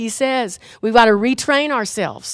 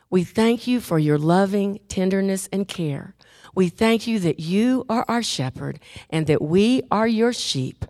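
softer, lower, slowing prayer fading out; tender closing prayer; 4 semitones lower, 3 dB quieter than usual. Acted. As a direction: bring the prayer gently to rest. Prayer closes softly.

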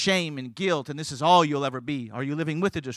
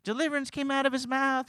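retold woman's testimony; 10 semitones higher than usual. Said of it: "She goes".

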